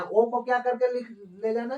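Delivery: ordinary delivery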